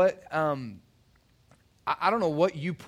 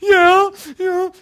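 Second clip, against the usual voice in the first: much higher, louder choked-up yeah; imitating a bawling kid; 15 dB louder than usual. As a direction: mimic a sobbing seventh grader. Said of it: "And he's like".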